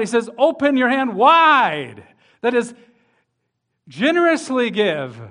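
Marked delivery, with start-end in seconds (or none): 0.4-2.0 louder, slow, emphatic "Open your hand wide"; stronger command. Shown as a demonstration. Preacher voicing God's stronger command to give, slow and loud. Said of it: "then God becomes even more strong about it".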